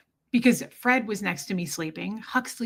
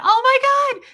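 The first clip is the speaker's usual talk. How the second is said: high, loud shocked cry; sister's shriek; 9 dB louder than usual. Retold or imitated. Sister hearing the dog died, loud high cry. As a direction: let it burst out high and loud. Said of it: "Brianna went".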